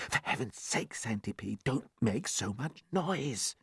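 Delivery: hushed, half-whispered pleading; soft. A narrator's reading. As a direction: beg him quietly to hush. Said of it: "James said softly".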